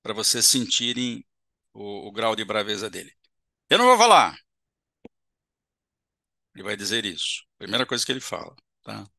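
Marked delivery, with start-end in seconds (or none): 3.7-4.4 loud, high, furious refusal; angry spirit refusing to talk. Shown as a demonstration. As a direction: snap it out like an enraged spirit. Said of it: "eu vou dar uma entonação de braveza".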